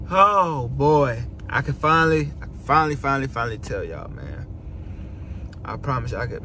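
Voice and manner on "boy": usual speaking voice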